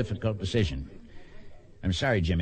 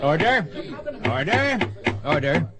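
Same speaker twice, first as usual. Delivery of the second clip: loud gavel-style call for order; shouting for order; 5 semitones higher, 3 dB louder than usual. Acted. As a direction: bark it out to silence the room. Judge calling courtroom to order.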